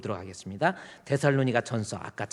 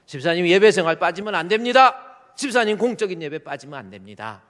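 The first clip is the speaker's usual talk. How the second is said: loud exhortation; 5 semitones higher, 10 dB louder than usual. Acted. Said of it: "권면은".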